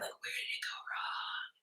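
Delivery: soft whisper to herself; whispered wondering. Retold or imitated. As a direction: whisper it like a private worry. Rereading old messages, wondering.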